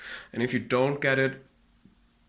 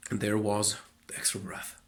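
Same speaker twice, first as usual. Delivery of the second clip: normal talking voice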